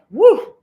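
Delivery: loud high whoop of joy; cheering her live audience; joyful whoop. Real, not acted. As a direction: let out a real cheer.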